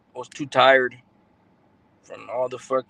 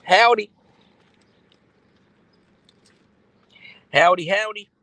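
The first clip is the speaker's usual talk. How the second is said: loud, higher country "howdy"; playful country greeting; 7 semitones higher, 9 dB louder than usual. Pretend. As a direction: holler a country-style howdy for fun. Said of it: "For the country girl".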